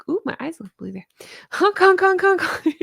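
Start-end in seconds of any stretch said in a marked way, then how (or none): 1.5-2.8 high, loud goofy honk honk; silly clown honking. Pretend. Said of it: "I might have lost the plot".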